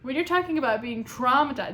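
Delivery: ordinary talk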